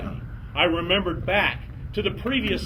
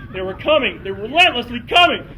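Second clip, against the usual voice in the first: louder and faster chase; building excitement; 6 semitones higher, 6 dB louder than usual. A narrator's reading. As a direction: build the panic.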